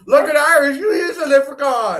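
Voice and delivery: loud, high teasing yell through laughter; laughing shout. Real, not acted. Host teasing cohost as a leprechaun, laughing shout. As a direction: shout it while cracking up.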